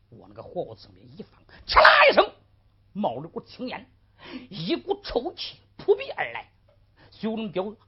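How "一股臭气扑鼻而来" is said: ordinary delivery, nothing marked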